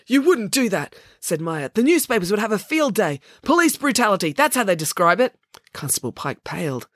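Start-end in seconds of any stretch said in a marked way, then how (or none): 0.1-0.9 high-pitched, indignant challenge; challenging disbelief. Acted. Maya challenges threatened search. 1.7-5.3 high, emphatic warning; indignant threat. Acted. Maya threatens press scandal.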